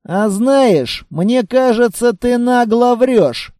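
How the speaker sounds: ordinary delivery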